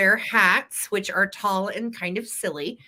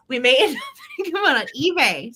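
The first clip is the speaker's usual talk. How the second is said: laughing correction; 8 semitones higher, 4 dB louder, faster than usual. Real, not acted.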